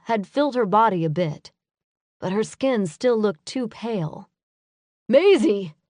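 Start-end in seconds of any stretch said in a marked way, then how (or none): 5.1-5.8 a higher, louder call of her name. Acted. Adam calling to Maisie as she returns, loud call. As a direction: call her across the graveyard. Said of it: "Adam called".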